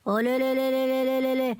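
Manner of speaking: long monotone run of "le"; exclaimed "le-le-le" trill. Acted. Ramanna reacts to the old woman's fuss, exclaimed le-le-le trill. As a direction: rattle off le-le-le on one note.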